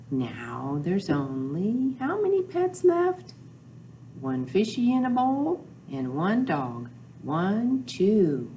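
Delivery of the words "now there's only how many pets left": usual speaking voice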